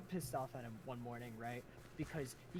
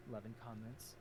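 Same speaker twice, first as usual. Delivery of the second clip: ordinary talk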